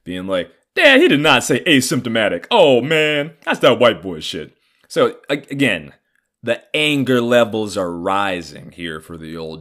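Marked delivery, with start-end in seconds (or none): 0.7-4.6 mocking half brother.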